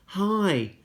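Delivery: small high timid voice; a shy little boy says hi; lightly voiced toddler; tiny child. A narrator's reading. Small brother greets.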